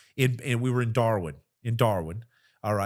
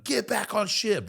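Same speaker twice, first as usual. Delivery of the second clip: yelling order; 11 semitones higher, 3 dB louder than usual. Retold or imitated. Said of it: "yelling at them".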